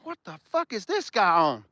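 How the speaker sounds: ordinary delivery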